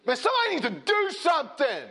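high loud laughing shout aping a crowd; laughing mock shout. Preacher mocking demanding crowd, high laughing shout. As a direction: yell it in mock outrage.

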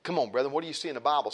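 ordinary talk

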